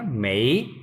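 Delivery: teacher's drawn-out rising "मई"; second tone. Shown as a demonstration. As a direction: model "मई" with a clear upward glide. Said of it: "second tone है ना".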